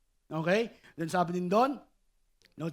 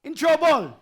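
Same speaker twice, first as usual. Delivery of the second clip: reading scripture aloud; 4 semitones higher, 8 dB louder, slower than usual. Acted. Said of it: "Can we read this together?".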